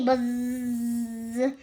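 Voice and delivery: a playful buzzing sound like a bee; mock bee buzz. Squirrel playing a bee, mock buzz.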